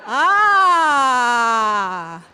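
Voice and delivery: sudden loud startled cry; startled shout. Real, not acted. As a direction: yelp out in surprise. Startled by an interruption.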